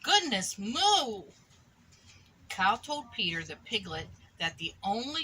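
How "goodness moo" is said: slow, a touch louder mooing gasp; cow exclamation. Acted. Cow hearing the piglet's hiccups, slow and amazed. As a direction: draw it out like a surprised cow. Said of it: "she said".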